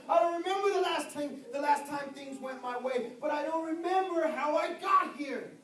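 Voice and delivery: loud and high, pressing the point like a pulpit climax; full-force preaching. Acted. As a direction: preach it out, building the fire.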